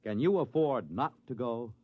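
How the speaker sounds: usual speaking voice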